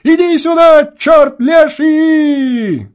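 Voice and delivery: put-on a boy yelling at his brother far away, gleeful angry shout. A narrator's reading. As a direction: shout with spiteful joy across the field. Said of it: "с радостным озлоблением закричал".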